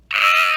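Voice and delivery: sudden loud scream; a huge startled scream. A narrator's reading. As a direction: let out a loud shocked scream. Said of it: "Oliver's scream shook".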